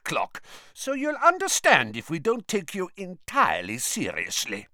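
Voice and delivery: high, coolly mocking character voice; mock him coldly, pitched well up; cold mockery. A narrator's reading. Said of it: "said Karkaroff coldly".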